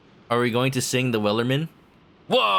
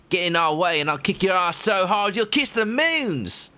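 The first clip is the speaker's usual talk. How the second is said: higher angry pirate; 9 semitones higher than usual. Acted.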